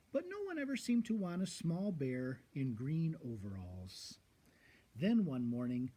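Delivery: usual speaking voice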